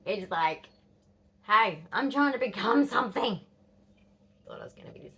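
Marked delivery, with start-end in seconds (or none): 1.4-3.4 imitated teen protest. Teen retorting to parent in story.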